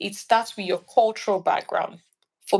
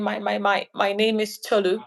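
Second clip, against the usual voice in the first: halting, stuttered self-introduction; stuttering. Shown as a demonstration. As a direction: trip on the first word before getting the name out. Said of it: "I'm saying".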